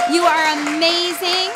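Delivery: loud, high, drawn-out cheer; excited cheer. Real, not acted. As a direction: shout it out to the donors, elated and slow. Host cheers donors' fundraising total.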